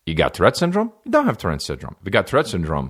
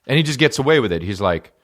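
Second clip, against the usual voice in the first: usual speaking voice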